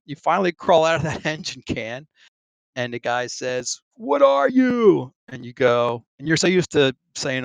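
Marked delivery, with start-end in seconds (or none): 4.0-5.1 high, slow bellowed question; shouted demand. Retold or imitated. Shellback shouting down at the crawling pollywog, slow bellowing question. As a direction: bark it down at the recruit like a drill boss.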